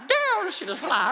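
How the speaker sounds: high, drawn-out talking-dog amazement; slow high marvel as an astonished dog; surprised dog. Retold or imitated. The dog reacting to the stars singing, surprised. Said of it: "The dog was even surprised".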